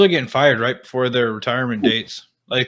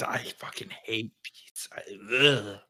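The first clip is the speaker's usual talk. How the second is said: whispered disgust; 3 semitones lower, 9 dB quieter than usual. Real, not acted. Private disgust after beet talk.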